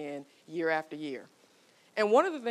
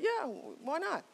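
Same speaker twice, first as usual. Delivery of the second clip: loosened-up husband; 9 semitones higher, 5 dB quieter than usual. Retold or imitated. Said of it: "He kinda loosens up".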